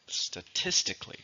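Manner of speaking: "Statistically" softly broken up, stress on TIS; stress on second syllable. Shown as a demonstration. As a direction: model "Statistically" carefully, leaning on TIS. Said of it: "stresses on the second".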